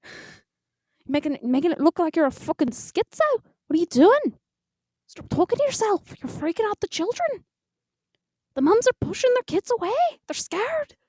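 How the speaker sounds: high, frantic fake-Irish scolding; hammed-up high Irish brogue; bad Irish accent. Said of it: "I talked to myself".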